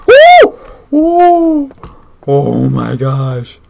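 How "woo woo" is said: high loud frightened whoops; scared shrieks. Real, not acted. Player spooked by the ghost, high loud whoops. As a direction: shriek out in fear.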